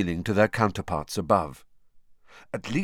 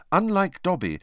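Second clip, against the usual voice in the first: ordinary delivery, nothing marked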